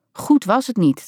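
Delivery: plain reading voice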